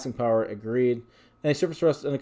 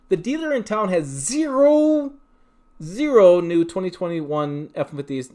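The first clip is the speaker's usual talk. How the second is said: exasperated emphasis; 6 semitones higher, 6 dB louder, slower than usual. Real, not acted. Exasperated about empty dealer lot.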